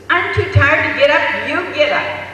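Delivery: loud, high-pitched tired mother snapping; acted irritable outburst, pushing the job away; quarrelling. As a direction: argue crossly with your husband. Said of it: "they start fighting back and forth".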